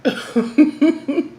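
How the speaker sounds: exasperated okay, then a laugh; resigned laugh. Retold or imitated. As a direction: laugh it off, fed up. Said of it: "And I'm just like".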